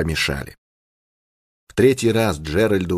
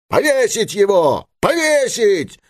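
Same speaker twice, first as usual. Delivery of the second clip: high, drawn-out angry cry; vicious shout; 14 semitones higher, slower than usual. A narrator's reading. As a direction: call for a hanging, stretching the repeat higher. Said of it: "злобно закричал кто-то". (злобный протяжный крик)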